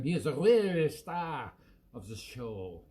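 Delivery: ordinary talk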